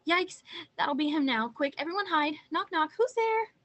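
high, loud storybook panic; hammed-up alarm climbing to the last question. Animals panic as wolf knocks.

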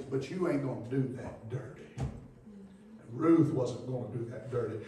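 ordinary talk

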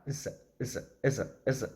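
is said soft and short, almost vanishing; swallowed is. Shown as a demonstration. Teacher showing how is shrinks to a weak, softened sound. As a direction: let the vowel of is die away. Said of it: "is 하면 소리가 쑥 죽어버려요".

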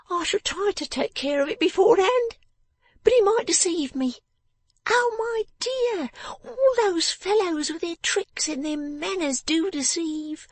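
very high, knowing little voice; sharp little Jenny. Acted. Jenny warns Lizzie about tricky fellows, high and knowing. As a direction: complain of deceivers in a high, slowed voice.

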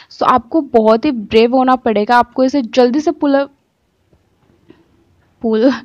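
lips restricted by mask; speaking while peeling mask; through stiff face mask. Real, not acted. Seen 0: Dried peel-off mask around her mouth, pulled off while speaking.

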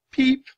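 high little chirp; a baby penguin's squeak; penguin peep. Acted.

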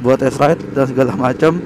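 usual speaking voice

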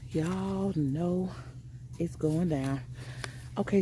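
normal talking voice

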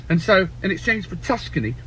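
ordinary delivery

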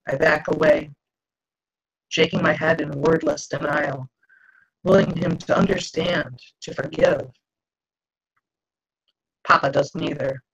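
plain reading voice

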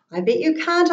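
high, loud challenge; playful shouted dare; shouting. Acted. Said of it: "I shouted".

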